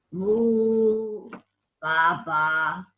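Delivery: lightly imitated moo and baa; quick mock animal calls; farm animal noises. Shown as a demonstration. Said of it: "What sort of noises".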